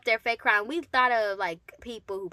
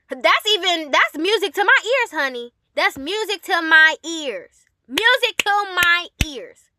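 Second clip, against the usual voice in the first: much higher and louder, drawn-out delight; gleeful; 8 semitones higher, 8 dB louder than usual. Real, not acted. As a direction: savor it like good news. Gleeful about teacher leaving.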